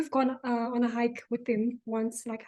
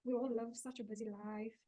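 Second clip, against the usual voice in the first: much softer than normal talk; 13 dB quieter than usual. Real, not acted. Co-host on busy modern living, very softly. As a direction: say it very quietly, without projecting. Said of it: "You sound very soft".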